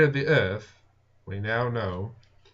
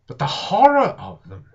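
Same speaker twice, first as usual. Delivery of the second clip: high, loud cry of horror; horrified outburst; 7 semitones higher, 6 dB louder than usual. A narrator's reading. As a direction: let the horror break through. Narrator recalls Martians in dread.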